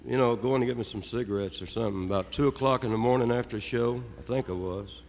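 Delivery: ordinary delivery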